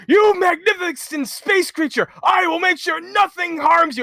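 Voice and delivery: high, slow, hammed-up awe; rapturous exclamation. Imitating Sid first meeting Jenova, grandly awestruck. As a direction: proclaim it grandly, stretching the words. Acted Sid greeting an alien.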